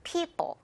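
people as pee-bo, the ending dropped softly; very light second p. Shown as a demonstration. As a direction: stress pee and barely touch the second syllable of people. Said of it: "굉장히 가볍죠".

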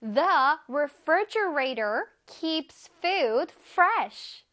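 very slow, clear English model sentence; teacher reading slowly to kids, each word clear. Shown as a demonstration. Teacher models the sentence for children, slow and clear. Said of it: "예문에서 보자".

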